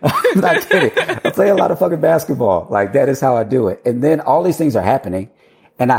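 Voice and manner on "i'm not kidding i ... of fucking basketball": high, laughing insistence; amused, laughing confession. Real, not acted. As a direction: laugh while insisting it's true. Laughing at his own answer.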